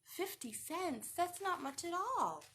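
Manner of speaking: ordinary delivery, nothing marked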